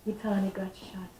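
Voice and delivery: hushed, breathless report; whispered bad news. Caller telling mother her son was shot, whispered.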